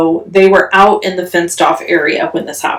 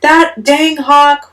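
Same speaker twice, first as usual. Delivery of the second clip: angry outburst; 8 semitones higher, slower than usual. Retold or imitated. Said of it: "I was lit".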